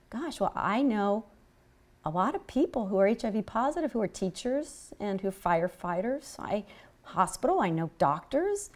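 softly voiced mild objection; retold hushed reply. Retold hushed exchange with the physician.

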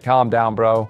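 ordinary delivery

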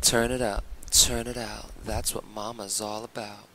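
loud sultry diva demo; sexy voice. Shown as a demonstration. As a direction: belt it like a sassy mama. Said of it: "Where was that voice?".

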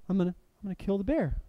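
very soft, quick, hesitant mumble; acted timid repeat. Preacher as the friend reluctantly repeating the vow, timid.

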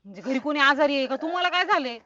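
high, loud worried questions; rising panicky concern; anxious alarm. Acted. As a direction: press him urgently for an answer. Worried woman questions weeping man.

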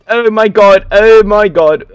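loud, high cry of alarm; startled, belted exclamation; panicked yell. Real, not acted. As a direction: yell out in fright at the game. Startled by attackers in game.

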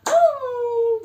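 high, loud playful gulp; gulping sound. Pretend. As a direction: gobble it up with a sudden hum. Ördekler balığı yutuyor. (yüksek ve oyunbaz yutma sesi)

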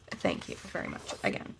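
whispered aside. Real, not acted. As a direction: whisper it privately.

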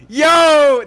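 loud high hype yell; excited shout. Real, not acted. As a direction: burst out with excitement. Sudden hype reaction.

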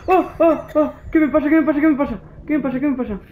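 high, frantic repeated question; startled player panicking at a sudden change; panicked. Real, not acted.

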